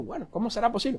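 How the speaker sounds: acted doubt; puzzled hesitation. Young man puzzled by pastor's plan, hesitantly. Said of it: "un poco extraño dijo".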